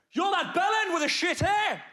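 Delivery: loud, high mock shout of a drunk; drunken heckle shout. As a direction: yell it like a rowdy drunk on a plane. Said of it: "What was it he said again?".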